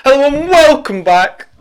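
high, bright announcer welcome; host intro voice. Pretend. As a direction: belt out the welcome like a show host. Podcast intro greeting.

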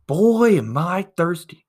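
high, loud, drawn-out moan to nobody; loud whiny complaint. Retold or imitated. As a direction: announce your thirst to the whole carriage. Stranger calls out again.

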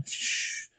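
soft, voiceless shh; a long hush for silence; shushing. Acted. Telling everybody to be quiet, shushing.

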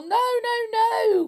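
very high, panicky plea; acted terrified boy crying out; frightened cry. A narrator's reading. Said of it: "cried Len".

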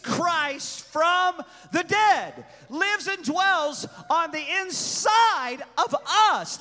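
loud, rising sermon delivery; preaching at full force. Acted.

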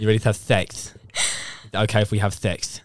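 put-on lisp, "sex" said as "thext"; mock lisp. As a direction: lisp every s in "ready to have sex" into th. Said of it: "You'd be like".

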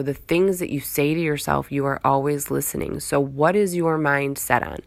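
ordinary delivery

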